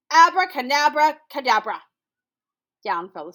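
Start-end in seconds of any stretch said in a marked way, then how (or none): 0.1-1.8 loud spell. Acted. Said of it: "Hyena said".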